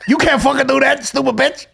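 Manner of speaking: high, loud mock Italian rant at his wife; angry Italian husband. Husband yelling at wife over a cocktail, high hammed-up rage. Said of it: "talking to his wife going".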